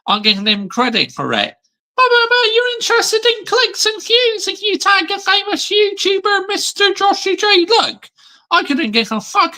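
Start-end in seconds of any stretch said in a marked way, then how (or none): 1.9-9.6 much higher, put-on mocking voice; squeaky mock impersonation of a critic; mocking whiny voice. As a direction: parody the clout-chaser in a silly high voice. Mocking a rival's clicks talk.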